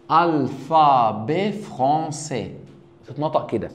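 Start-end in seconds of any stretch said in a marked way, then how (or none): 0.1-2.5 "الفابي فرونسي" slowly, syllables split, French r; slow careful French alphabet français; French pronunciation. Shown as a demonstration. Teacher asks class to watch how he says it, French pronunciation. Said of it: "وانا بنطقها بنطق ازاي؟".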